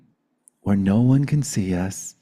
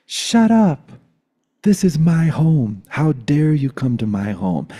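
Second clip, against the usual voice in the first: loud, high first command, easing as it goes; indignant outburst; 6 semitones higher, 4 dB louder than usual. Retold or imitated. Boyfriend opening the door to his brothers, indignant shout. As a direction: imitate someone furiously defending their home.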